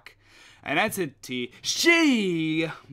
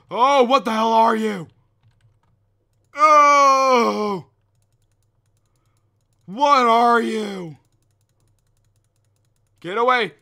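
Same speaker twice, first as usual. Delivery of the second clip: much higher, much louder panicked yells; terrified screaming; 10 semitones higher, 15 dB louder, slower than usual. Real, not acted. Player screaming in terror at the monster in the hallway. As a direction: scream as the monster comes at you.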